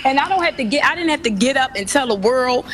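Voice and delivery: loud, forceful outburst; outraged. Real, not acted. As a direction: fire back at the critics, loud. Mother outraged at critics.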